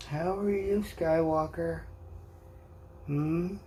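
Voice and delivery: high-pitched coo to a cat; pet talk. Real, not acted. Baby-talking to the pet cat.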